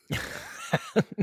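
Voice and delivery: real amused laugh; high laugh. Real, not acted. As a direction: burst of high-pitched laughter. Laughing at joke.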